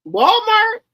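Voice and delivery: ordinary talk, nothing marked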